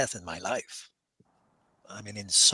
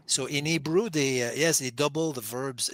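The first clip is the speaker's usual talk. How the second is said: ordinary delivery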